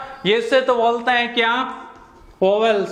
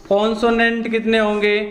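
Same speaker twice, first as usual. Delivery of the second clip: ordinary talk, nothing marked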